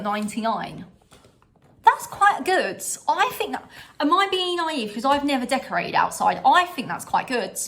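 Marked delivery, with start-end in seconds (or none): 1.8-4.9 high, bright surprise at a price; delighted, pitched up; excited. Real, not acted.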